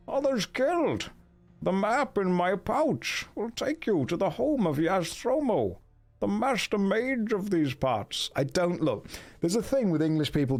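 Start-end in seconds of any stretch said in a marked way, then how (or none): 0.1-8.3 high, slow, plummy masked-villain impression; Bane. Dwarf explaining the map in his pouch, as a slow, high villain. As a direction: stretch it out, pitched high and theatrical. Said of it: "that's Bane, isn't it".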